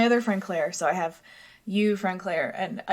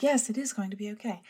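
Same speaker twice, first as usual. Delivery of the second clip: mildly acted, comforted agreement; convinced agreement; 2 semitones higher, 4 dB quieter than usual. Herself answering her comforting co-host, mildly acted conviction. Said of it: "And I'm like".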